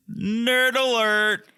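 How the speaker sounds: high, drawn-out singsong call; slow, high-pitched teasing announcement; mock alert announcement. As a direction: stretch it out high like a playful alarm. Jokey announcement of couple's nickname.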